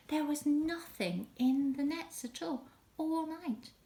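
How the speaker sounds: put-on dramatic children's storytelling. A narrator's reading. Storyteller telling children about the fruitless night's fishing, dramatically. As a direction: stretch out the long night for young listeners.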